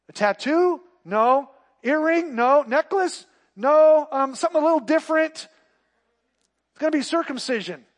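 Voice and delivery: loud, high, driving sermon voice; full-force preaching. Acted. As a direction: press the point hard to the church.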